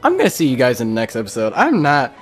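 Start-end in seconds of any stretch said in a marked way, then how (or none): none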